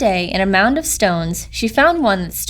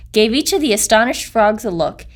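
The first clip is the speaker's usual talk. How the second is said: ordinary delivery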